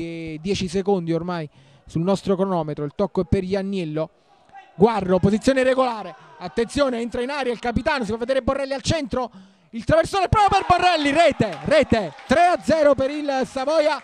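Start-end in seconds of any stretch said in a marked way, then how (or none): 4.8-14.0 loud, high, shouted goal call; breathless italian goal commentary. Real, not acted. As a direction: yell the goal as it goes in.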